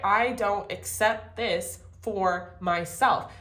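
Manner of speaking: I don't accept this for myself, slow and deliberate; slow firm declaration. Shown as a demonstration. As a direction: say each word slowly and firmly. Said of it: "Do you hear that, God?".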